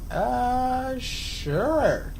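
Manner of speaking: reluctant rookie yes; hesitant agreement. Retold or imitated. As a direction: stall on the uh, then agree uncertainly. Unsure new guard answering patron.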